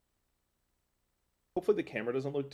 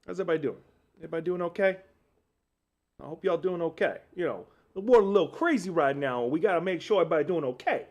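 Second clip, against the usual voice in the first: a hint of a drawling Southern y'all voice, raised; lightly voiced Southern accent, higher and a bit faster; put-on Southern accent; 4 semitones higher than usual. Greeting chat in a mock Southern accent. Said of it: "I don't know where the accent came from".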